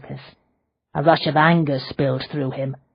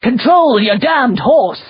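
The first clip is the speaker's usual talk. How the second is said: slow, much higher, louder furious command; angry shout; 9 semitones higher, 7 dB louder, slower than usual. A narrator's reading. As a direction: bellow it at the coachman. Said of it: "Thaddeus shouted".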